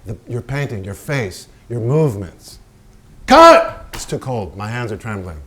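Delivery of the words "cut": yelled cut, high and loud; furious director yelling cut; director's shout. Acted. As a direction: bark the cut across the set. Director shouting on film set.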